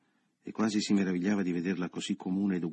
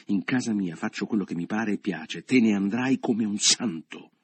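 domineering; 2 semitones higher, 6 dB louder than usual. A narrator's reading. Leo throws Michele out of his house, domineering.

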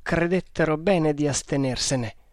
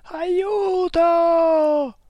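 high desperate shout from far off; distant cry for help; 6 dB louder, much slower than usual. A narrator's reading. Drowning man cries from the river, desperate distant shout. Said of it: "s'udì gridare".

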